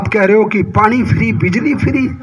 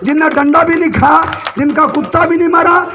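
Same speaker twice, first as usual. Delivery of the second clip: full-voiced political speech, phrase by phrase; forceful stump-speech delivery; rally oratory; 5 semitones higher than usual. Real, not acted. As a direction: address a big crowd in emphatic, sustained bursts.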